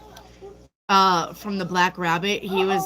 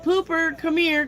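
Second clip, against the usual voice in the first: higher, sing-song call across the house; dad calling his daughter Pooper, higher and sing-song; 10 semitones higher than usual. Retold or imitated. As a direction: call your kid from the other room. Dad calling her from afar.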